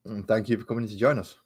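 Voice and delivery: usual speaking voice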